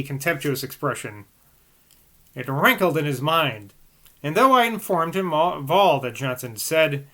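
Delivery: put-on measured, even reading of an old text; calm literary reading voice; reading aloud. A narrator's reading. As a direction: read it like a steady audiobook narrator.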